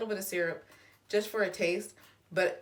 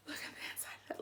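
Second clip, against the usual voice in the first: breathy awed whisper; 15 dB quieter, faster than usual. Real, not acted. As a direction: whisper it in awe. Repeated, whispered.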